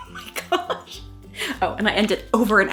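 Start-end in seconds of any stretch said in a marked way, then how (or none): 0.0-1.0 breathless laughing whisper. Real, not acted. Laughing at old entry.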